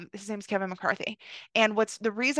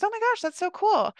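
excited congressman; 11 semitones higher, 3 dB louder than usual. Retold or imitated. Congressman reacting, quoted by teller.

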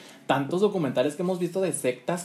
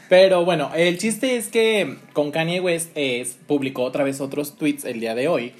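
normal talking voice

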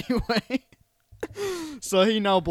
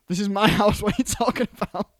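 mock indignant; 6 semitones higher, 7 dB louder, faster than usual. Eddie voiced, indignant, laughing.

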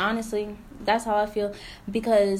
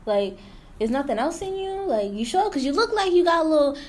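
mock doubting asker; 5 semitones higher than usual. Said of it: "somebody will say".

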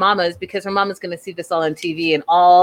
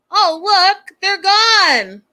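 mock bright announcement; 9 semitones higher, 5 dB louder, much slower than usual. Mock demo of deleting photos.

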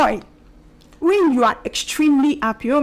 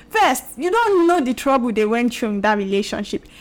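normal talking voice

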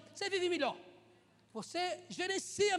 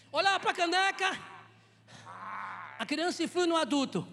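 loud, emphatic pulpit delivery; preaching; 6 dB louder than usual. Real, not acted. Preacher pointing at the broken mug, forceful preaching. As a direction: project it to the whole church. Preaching over helper's acted tantrum.

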